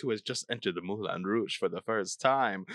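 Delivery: hammed-up French cabaret host; teasing French-accented welcome ending in a chortle. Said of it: "I hate this accent so much".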